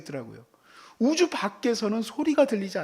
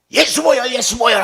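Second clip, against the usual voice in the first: higher, louder mock chant of a shaman-like preacher; mock the preacher crying out in a frenzy; frenzied cry; 4 semitones higher, 7 dB louder than usual. Mocking other preachers, higher and louder mock cry. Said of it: "하고 다니래".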